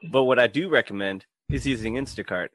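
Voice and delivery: ad read. Acted. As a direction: sell it like a podcast ad. Sponsor read for Instacart.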